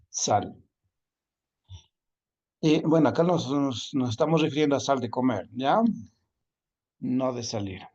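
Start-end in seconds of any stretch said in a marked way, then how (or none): none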